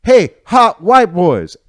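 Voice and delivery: high, loud, slow catcall; girl's yell. Retold or imitated. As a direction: call it across the street. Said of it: "this one girl yells".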